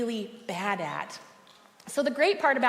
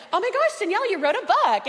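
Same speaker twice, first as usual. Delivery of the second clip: much higher, bubbly gush; Holly's high excited voice; 7 semitones higher, 4 dB louder than usual. Retold or imitated. Friend gushing at the makeup counter, high and excited. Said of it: "she totally did talk like this".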